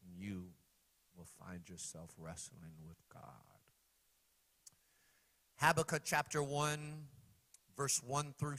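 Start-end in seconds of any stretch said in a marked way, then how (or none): 0.0-3.2 soft, low intimate appeal; quiet aside. Acted. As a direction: draw them in close.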